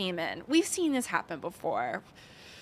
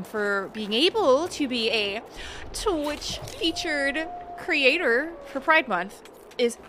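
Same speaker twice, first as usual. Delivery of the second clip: normal talking voice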